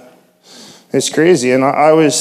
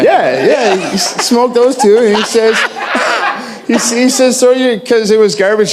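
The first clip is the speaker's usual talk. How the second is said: high, breathless giggling through the words; can't stop laughing mid-story; laughing while talking; 11 semitones higher, 4 dB louder than usual. Real, not acted. A shy man laughing as he tells the church about his last cigarettes.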